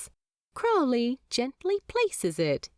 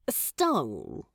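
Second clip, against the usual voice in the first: smug; 6 semitones lower than usual. A narrator's reading. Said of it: "Crawley corrects smugly".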